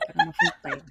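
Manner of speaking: laughing embarrassed denial. Real, not acted. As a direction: squeal it through a laugh.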